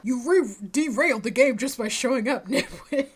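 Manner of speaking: jeering character. Acted. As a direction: throw it out as a mocking insult. Game character jeers at the player.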